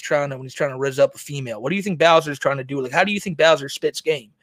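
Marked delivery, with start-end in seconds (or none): none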